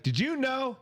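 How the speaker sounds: high, put-on voice imitating grandpa asking; mock grandpa question.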